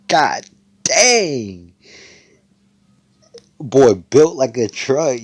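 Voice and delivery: loud, high, drawn-out amazement; hammed-up gawking at a muscleman; awestruck exclamation. Noelle reacts to his flexing muscles.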